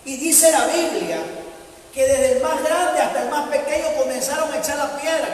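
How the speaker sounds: usual speaking voice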